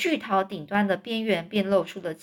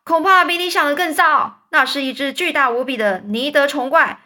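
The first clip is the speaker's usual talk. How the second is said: high loud cry; high loud alarm; shouting; 5 semitones higher, 5 dB louder than usual. A narrator's reading. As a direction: shout the bad news. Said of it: "警长叫喊着说".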